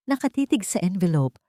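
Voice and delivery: ordinary delivery, nothing marked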